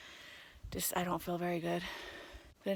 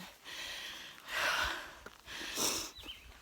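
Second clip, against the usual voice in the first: heavy sigh; 6 dB quieter than usual. Real, not acted. Stressed after hitting head.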